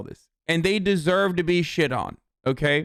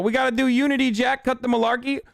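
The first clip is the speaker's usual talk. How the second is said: higher, hammed-up old Biden voice; mock Biden cadence, raised pitch; Biden impression; 8 semitones higher, faster than usual. Mocking Joe Biden's unity talk, higher hammy impression. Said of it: "Joe Biden constantly talking about".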